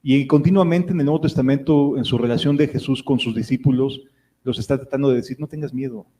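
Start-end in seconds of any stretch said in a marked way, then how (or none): 5.4-6.1 soft, calm comfort; quiet soothing words; gentle reassurance. Acted. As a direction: hush a frightened child. God soothing a fearful believer.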